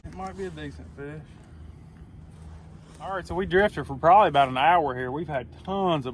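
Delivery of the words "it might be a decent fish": talking through a mouthful of egg; mouth full. Real, not acted. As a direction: still chewing, words muffled. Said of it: "I got my hard-boiled egg in my mouth".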